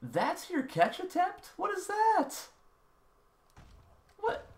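mock the receiver in a squeaky voice; mocking disbelief. Real, not acted. Mocking a failed catch.

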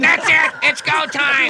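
high, loud battle cry; fighting yell. Acted. Host launching into fight with guest, fighting yell. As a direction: yell it to start a brawl.

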